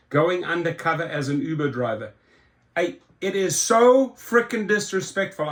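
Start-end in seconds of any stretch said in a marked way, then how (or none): 2.8-5.5 real exasperation, higher in pitch; pissed off. Real, not acted. Fed-up driver calls the CEO disrespectful, exasperated. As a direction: let real annoyance push the pitch up. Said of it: "Getting really pissed off here".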